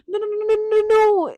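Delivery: high, rapid string of denials; mock hasty reassurance. Henry dismissing Dorian's guilt, high mock reassurance. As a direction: shoo the worry away, playfully.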